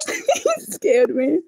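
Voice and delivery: high, slow, shaky laugh-cry; laughing after a scare. Real, not acted. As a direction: giggle through the leftover fright.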